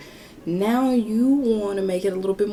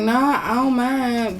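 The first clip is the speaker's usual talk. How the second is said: high, drawn-out casual yes; breezy agreement; 6 semitones higher, 5 dB louder, slower than usual. Retold or imitated. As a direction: stretch it out high, playing it casual. Retold eager yes to his text.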